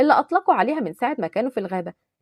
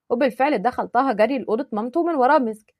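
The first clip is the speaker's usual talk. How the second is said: usual speaking voice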